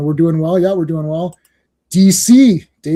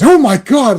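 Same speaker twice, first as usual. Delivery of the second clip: higher, louder mock alarm; retold fright; 6 semitones higher, 5 dB louder than usual. Wife sees big beard on the screen, mock fright. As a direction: imitate a wife's startled yelp. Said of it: "Yeah, she got scared".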